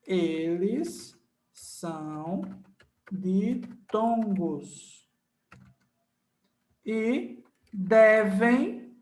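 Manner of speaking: each word set apart, slowly; teacher dictates for notes; dictating slowly. Shown as a demonstration. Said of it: "dictating the rule".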